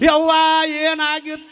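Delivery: high, drawn-out singsong lament; stretched-out, hammed-up keening; mock mourning wail. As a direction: imitate mourners wailing on arrival. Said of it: "ಇದೊಂದು ಭಜನದ ಮ್ಯಾಳ ಇದ್ದಂಗ".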